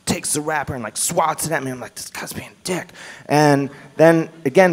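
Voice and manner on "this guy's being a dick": whispered aside. Retold or imitated. Private thought about the man.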